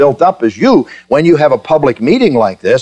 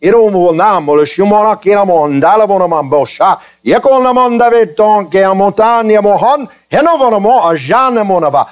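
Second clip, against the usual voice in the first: higher, flowing stream of made-up syllables; mock speaking in tongues; 6 semitones higher than usual. Shown as a demonstration. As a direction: rattle off invented tongue-speech without a pause. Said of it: "If I were to stand up here tonight and go".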